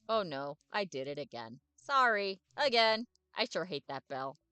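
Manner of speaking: loud, bouncy, overexcited puppy; eager dog voice. Acted. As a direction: bark every word out like a happy dog. Dave apologises for barking.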